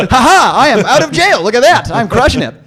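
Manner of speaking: high, loud mock gloating laugh; gloating dad. Son mocks his jailed dad, gloating loudly. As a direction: crow like a freed jailbird. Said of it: "yeah, my dad".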